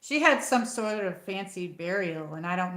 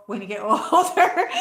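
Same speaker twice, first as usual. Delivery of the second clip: real laugh breaking into the words, rising; 3 semitones higher, 8 dB louder than usual. Real, not acted. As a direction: chuckle through it, getting louder. Laughs about getting older.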